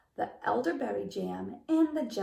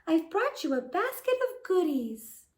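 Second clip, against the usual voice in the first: put-on high sweet girlish voice; wolf pretending to be a girl; little girl's voice; 8 semitones higher, 4 dB louder than usual. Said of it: "in a little girl's voice".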